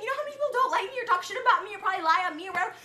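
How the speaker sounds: fast, high rushed rant; rapid rant. Real, not acted. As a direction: spill it out in one breath. Fast ranting question.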